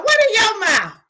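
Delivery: high, loud mocking echo. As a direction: throw the question back mockingly.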